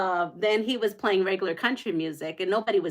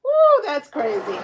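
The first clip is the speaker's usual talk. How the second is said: amazed whoop; 6 semitones higher, 6 dB louder, slower than usual. Retold or imitated. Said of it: "I was like".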